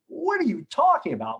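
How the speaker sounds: high, baffled what are you talking about; incredulous. Retold or imitated. To the partner who blamed him, stunned and incredulous. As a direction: react as if he can't believe what he heard. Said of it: "But I didn't say this".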